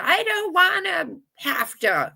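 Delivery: high, whining I don't wanna have to; mock a reluctant younger self, pitched high; whiny refusal. To her co-host, mocking her old self in a high whine. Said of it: "being like".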